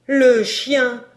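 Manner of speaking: frightened maid forcing out words; gasped out. A narrator's reading. Old maid bursting in terrified: gasped cry. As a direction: force it out, terrified. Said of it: "articula péniblement ces mots".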